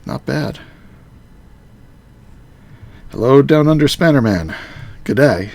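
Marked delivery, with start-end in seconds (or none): 3.1-5.5 loud, hammed-up Aussie greeting; bad Australian accent. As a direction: call out a g'day in a dodgy Australian accent. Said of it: "That was a horrible accent".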